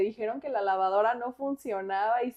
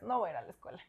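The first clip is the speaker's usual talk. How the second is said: soft, low grumbled refusal, much softer; sleepy refusal; 3 semitones lower, 10 dB quieter, much faster than usual. Retold or imitated. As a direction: grumble it half asleep. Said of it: "otra vez". (negativa adormilada y baja)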